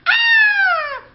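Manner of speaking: very high loud playful shriek; mock scare shriek. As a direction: squeal to frighten the mouse. Cat frightens the mouse.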